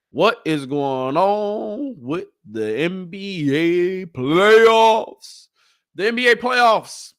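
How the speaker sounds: slow, drawn-out build to a loud high "playoffs"; hyped announcer. Acted. Vlogger hyping up the NBA playoffs to viewers, drawn-out announcer call. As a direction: stretch it like a ring announcer calling a fight.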